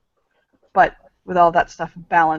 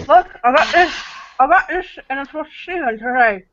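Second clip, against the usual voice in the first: high character voice; 7 semitones higher than usual. Acted. Priest character pleads at door.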